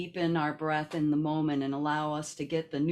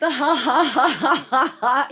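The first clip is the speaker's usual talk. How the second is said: laughing exhale; 6 semitones higher, 11 dB louder than usual. Shown as a demonstration. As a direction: laugh it out big and loud. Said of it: "letting your breath out in a laughter sound".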